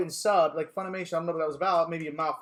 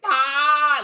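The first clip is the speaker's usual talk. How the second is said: startled cry; 8 semitones higher, 10 dB louder than usual. Retold or imitated. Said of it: "I was like".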